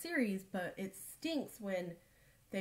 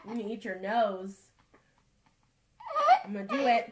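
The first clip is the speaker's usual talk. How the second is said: playful threat; 7 dB louder, faster than usual. Real, not acted. Playful tickle threat to child.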